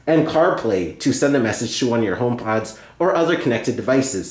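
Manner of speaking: ordinary talk, nothing marked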